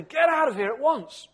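high, loud furious yell at the intruder; furious shout. A narrator's reading. Boy in the mansion yells at the sooty figure: furious high shout. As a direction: shout him out of the room, outraged. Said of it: "he shouted furiously".

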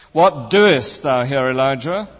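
slow question with "doest" punched; stress on DOEST. Retold or imitated. Friend mimicking a preacher's emphasis, stress on "doest". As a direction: lean hard on the second word, "doest". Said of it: "emphasizing each word in turn".

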